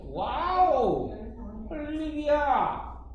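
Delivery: very slow, loud, high exclamation; theatrical cry of delight, drawn long and loud; mock amazement. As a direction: stretch out a hammed-up wow. Mock amazement opening second-year material.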